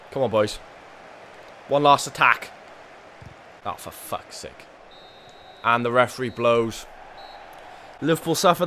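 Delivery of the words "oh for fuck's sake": soft whispered frustrated curse; frustrated mutter. Real, not acted. Manager losing the last attack, whispered curse. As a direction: mutter it under your breath. Late chance lost.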